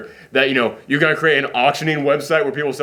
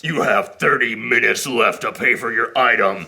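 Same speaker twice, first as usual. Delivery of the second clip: lowered, put-on tough-guy voice; big muscular dude; 5 semitones lower than usual. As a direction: play the hulking heavy. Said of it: "this big muscular dude".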